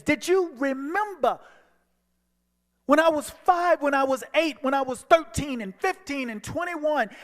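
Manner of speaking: high, loud, hurt outburst; reliving the anger, voice lifted and pressing; pleading accusation. Retold or imitated. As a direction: throw the old wounds at him. Confronting father over the phone.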